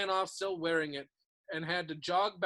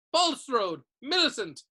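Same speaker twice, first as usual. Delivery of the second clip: calling out names; 7 semitones higher, 6 dB louder than usual. A narrator's reading.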